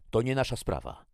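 loud, high, curt dismissal; sharp interruption. A narrator's reading. Jackie cutting off the man's plea, sharp and loud. Jackie cuts off the pleading stranger.

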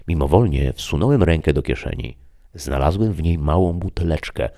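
ordinary delivery

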